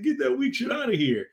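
put-on kid trash talk. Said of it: "He's like".

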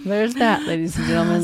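mock announcer.

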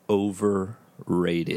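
slow, deliberate "Overrated"; deadpan verdict. Real, not acted. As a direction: drag out "Overrated" as a dry verdict. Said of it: "First word comes to mind".

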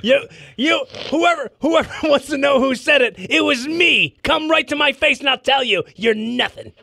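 loud, high heel promo; wrestling promo. Pretend. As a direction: call him out like a heel.

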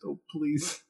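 soft mock begging; mock pleading. Suggesting a begging text back to her, mock pleading. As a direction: beg softly, putting it on. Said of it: "some kind of pleading, begging".

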